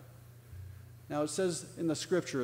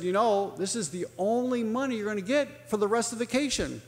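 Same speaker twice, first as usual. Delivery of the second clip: mildly stern mock-parent warning, pitched up; stern father; 6 semitones higher than usual. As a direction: lay down the rule as Dad, firm and raised. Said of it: "And very sternly said".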